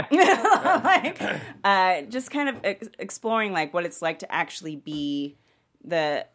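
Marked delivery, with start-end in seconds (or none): 0.0-1.2 bursts into a loud laugh mid-word. Real, not acted. Laughing while retelling the talk with mom.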